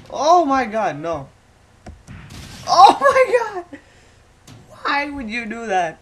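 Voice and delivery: high, loud horrified shout; shocked yelling as the gorilla kills him; shocked outcry. Real, not acted. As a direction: scream at the gorilla in disbelief.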